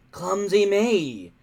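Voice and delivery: bully faking an apology; smug grin. A narrator's reading. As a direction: say it with a sneering grin. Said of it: "grinned Snotlout".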